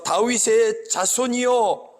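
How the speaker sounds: high, slow, stretched call; crying out. Retold or imitated. Beggar stops Jesus with a high, slow cry. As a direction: call out to him as he passes, drawn out. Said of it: "이 외침이".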